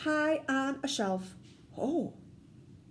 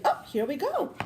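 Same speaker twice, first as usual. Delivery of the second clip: ordinary talk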